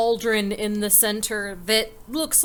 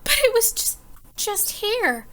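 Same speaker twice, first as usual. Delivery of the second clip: flustered; 12 semitones higher than usual. Acted. Bartender searching in panic.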